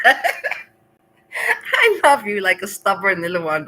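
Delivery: slow, loud laughing tease; teasing laugh. Real, not acted. Streamer to stubborn chatter Eggy, slow laughing tease. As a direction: laugh it out fondly.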